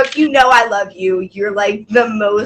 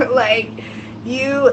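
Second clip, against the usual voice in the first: ordinary delivery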